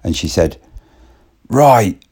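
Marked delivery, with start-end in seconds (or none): 1.5-2.0 high, louder curt snap; fed-up snap. Retold or imitated. Woman at the end of her patience, curt and loud. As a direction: cut him off sharply. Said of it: "she's had enough".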